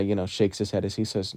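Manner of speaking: normal talking voice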